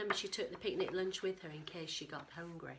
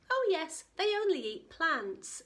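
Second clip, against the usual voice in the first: reassuring Hadrosaurus; 8 semitones higher, 6 dB louder than usual. A narrator's reading.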